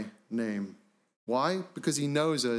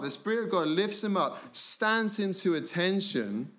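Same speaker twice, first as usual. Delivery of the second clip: normal talking voice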